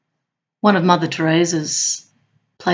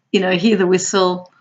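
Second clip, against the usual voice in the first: normal talking voice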